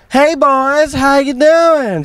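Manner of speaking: high, drawn-out Southern mom calling out; Southern accent. Retold or imitated. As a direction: holler a hello across the yard in a Southern accent. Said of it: "I just made it southern".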